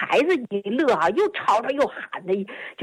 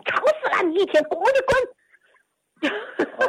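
high, sharp angry yell of "滚"; grandma yelling scold; 11 semitones higher than usual. Retold or imitated. Grandma yells at grandson, high angry shout. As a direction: snap at the kid to get out. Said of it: "就冲着他大孙子就喊".

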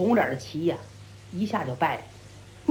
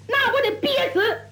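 high-pitched venting; exasperated; 9 semitones higher than usual. A narrator's reading. Brawler complains of being forbidden to speak, exasperated. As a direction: burst out in frustration.

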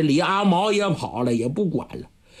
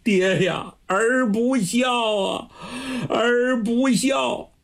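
crying; 6 semitones higher than usual. A narrator's reading. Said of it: "此时就哭开了".

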